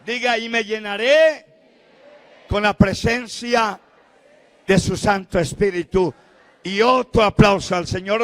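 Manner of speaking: loud, ringing pulpit exhortation; fiery preacher calling out to the congregation; full-force preaching. Acted. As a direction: preach it out to the whole church.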